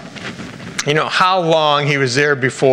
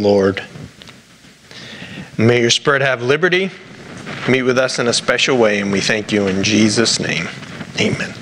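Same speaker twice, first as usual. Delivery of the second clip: prayer. Acted. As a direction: pray it humbly and sincerely. Opening prayer before sermon.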